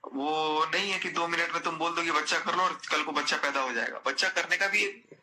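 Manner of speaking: normal talking voice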